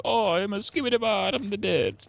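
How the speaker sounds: squeaky high mock Italian accent; cartoon Italian accent, very high; mock Italian Pope. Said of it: "Except he's Italian or something".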